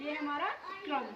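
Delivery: ordinary talk, nothing marked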